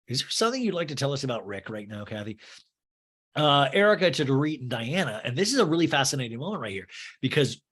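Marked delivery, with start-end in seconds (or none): none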